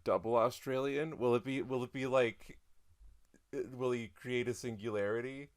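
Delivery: ordinary delivery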